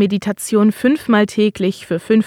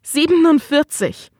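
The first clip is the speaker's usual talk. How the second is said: pitched-up, stunned figure; rising anguish; 6 semitones higher than usual. Acted. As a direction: let the number rise. Husband's anguished outburst. (fassungslos, höher)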